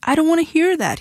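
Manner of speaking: high-pitched, understated shout; shouted protest. A narrator's reading. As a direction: refuse loudly, pitch jumping up. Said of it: "he shouted".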